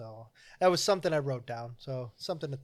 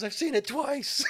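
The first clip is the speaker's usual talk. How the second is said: guide; 12 semitones higher, 5 dB louder than usual. Retold or imitated. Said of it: "And he goes".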